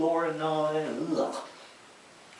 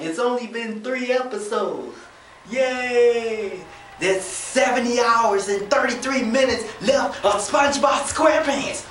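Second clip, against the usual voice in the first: put-on higher, louder, bouncy kid voice; mock kid excitement; 7 semitones higher, 4 dB louder than usual. Imitating the kids excited about the remaining hours, high and loud. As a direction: cheer like a thrilled child. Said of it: "the kids are just excited".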